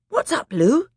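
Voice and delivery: put-on high startled boy's question; alarmed young boy. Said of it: "said Edmund".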